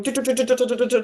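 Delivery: rapid "do-do-do" babble imitating unintelligible accented speech. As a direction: rattle off syllables as mock incomprehensible speech. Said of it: "with an accent".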